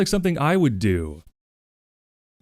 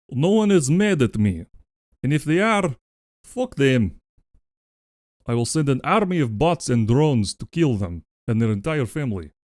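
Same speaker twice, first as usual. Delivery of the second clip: put-on Russian accent. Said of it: "let's do it in Russian".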